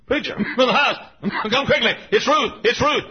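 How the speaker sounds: high, loud panicked plea with laughter; frantic husband. Retold or imitated. Husband begging preacher on phone: frantic, high, loud.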